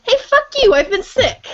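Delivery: high indignant frog. Acted. Frog answering the lion, high indignant retort. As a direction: snap back like an offended little frog. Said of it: "and the frog says".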